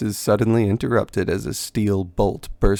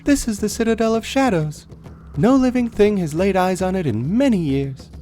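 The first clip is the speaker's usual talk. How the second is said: higher, hushed-urgent warning; uneasy companion; 12 semitones higher than usual. Acted. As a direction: warn them with awe. Companion warns near the citadel.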